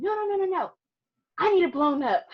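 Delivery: high, loud imitated husband, excited; hammed-up husband begging for a bigger print; eager insistence. Husband to photographer, imitated loud excited insistence.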